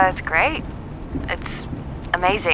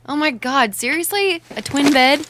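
high-pitched, louder disbelief; incredulous dismay; 7 semitones higher, 5 dB louder than usual. Acted. Friend discovers her room has a twin bed, incredulous. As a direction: protest in rising, appalled disbelief.

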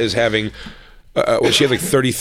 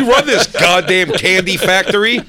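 mock ranting; 8 semitones higher, slower than usual. Mock rant at agent.

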